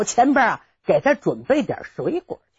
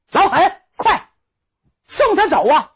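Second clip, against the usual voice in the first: 远远喊一嗓子; 7 semitones higher, 4 dB louder than usual. Acted. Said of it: "有人喊了一嗓子".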